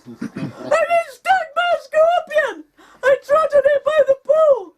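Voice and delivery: high, loud, sobbing panic; hysterical crying scream. Retold or imitated. Stung wife explaining to husband, hysterical sobbing shout. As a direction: wail it out half-crying. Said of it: "making me even more hysterical".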